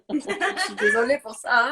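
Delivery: laughing through a French apology. Real, not acted. Apologising to French fans, laughing at her own accent. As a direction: giggle as you apologise in French. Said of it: "mon accent".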